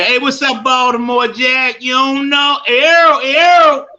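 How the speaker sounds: high, loud Baltimore hollering; rowdy Baltimore greeting, climbing to a loud high cry; Baltimore street talk. Shown as a demonstration. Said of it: "all that Baltimore shit".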